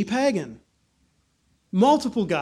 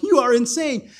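raised, louder voice; exclaiming; 5 semitones higher, 6 dB louder than usual. Acted. Festus to Paul, a raised exclamation. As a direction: blurt it out at him. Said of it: "he's like".

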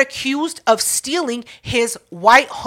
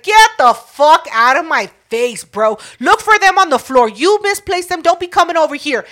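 louder, indignant retelling of a telling-off; angry retort; 5 semitones higher, 5 dB louder than usual. Retold or imitated. Concertgoer to man accusing her of theft, angry retort. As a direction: reenact shooing an accuser away, loud and rising. Retold quarrel over lost glasses.

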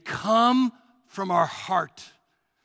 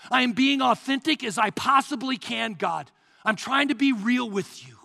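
loud, urgent crying out to God; impassioned plea, raised in pitch; courageous, heartfelt prayer; 3 semitones higher, 5 dB louder than usual. Acted. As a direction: pour your heart out, insistent and driving. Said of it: "praying with courage".

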